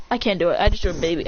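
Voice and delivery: ordinary delivery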